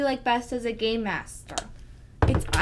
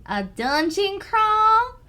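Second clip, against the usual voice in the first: slow, loud Southern drawl, higher; southern accent; 9 semitones higher, 8 dB louder, much slower than usual. Shown as a demonstration. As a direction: drawl each option like a cowboy. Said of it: "Try this one in a southern accent".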